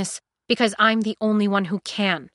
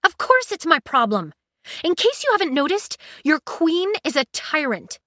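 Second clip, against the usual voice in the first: raised, heated retort; 7 semitones higher, 6 dB louder than usual. Acted. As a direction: argue back hard and loud. Heated argument with Jason.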